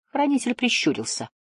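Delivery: ordinary delivery, nothing marked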